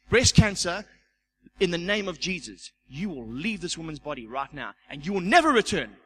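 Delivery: loud, forceful command to the sickness; commanding rebuke. Retold or imitated. Elder rebuking the cancer in a sick woman's body, loud and commanding. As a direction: order the cancer out like you own the room.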